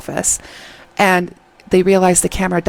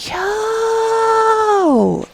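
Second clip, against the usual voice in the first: excited shout. Real, not acted. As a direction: shout it out in sheer delight. Gushing over the actor's piano.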